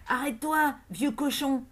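put-on a girl's high, loud shout to stop him. A narrator's reading. A sullen girl shouting at the fleeing old man, high and loud. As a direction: yell it out to block his way. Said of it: "une bouche large qui criait".

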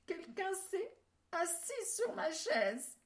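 high, small little bear voice; tiny bear voice. A narrator's reading. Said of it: "d'une voix toute petite". (toute petite voix d'ourson)

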